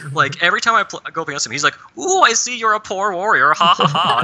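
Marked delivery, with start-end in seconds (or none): 2.0-4.2 higher, taunting villain voice ending in a mock laugh. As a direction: tease the player like a gloating boss. Villain mocking the player.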